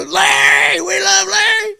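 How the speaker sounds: very high, loud fan screaming; piercing mock crowd cheer; adoring fans shouting. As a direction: imitate an audience hollering its love. Imitates adoring crowd at his show.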